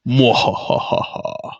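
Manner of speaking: slow whispered villain cackle; breathy mock evil laugh. Mock villain costume reveal.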